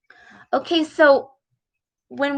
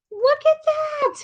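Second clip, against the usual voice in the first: excited; 3 dB quieter than usual. Real, not acted. Teacher delighted at picture.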